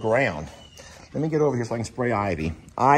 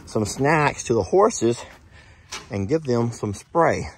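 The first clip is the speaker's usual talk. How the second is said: ordinary talk, nothing marked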